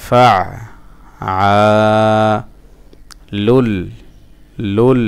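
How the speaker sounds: ফা, long আ, then লুল, each apart; broken-up syllables. Shown as a demonstration. Teacher sounding out a word for learners, piece by piece. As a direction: chant ফা আ লুল slowly with gaps. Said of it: "ভাঙা ভাঙা উচ্চারণ".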